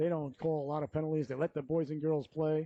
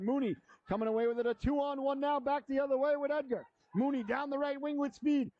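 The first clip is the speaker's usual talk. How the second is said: higher, rising play-by-play on a rush; excited call; 10 semitones higher than usual. Real, not acted. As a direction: build the excitement as the rush comes. Two-on-one rush call.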